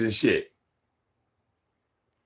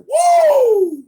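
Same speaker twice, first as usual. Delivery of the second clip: much higher, louder laughing shout; mock celebratory yell; joyful whoop; 8 dB louder than usual. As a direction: whoop with relief. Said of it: "kick my feet up like".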